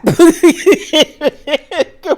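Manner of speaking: a sudden high, loud laugh; high burst of laughter. Real, not acted. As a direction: crack up at the absurdity. Laughing at Candace's claim.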